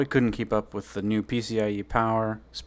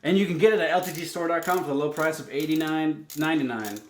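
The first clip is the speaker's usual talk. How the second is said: ad read; 5 semitones higher than usual. Pretend. Mock ad read for store.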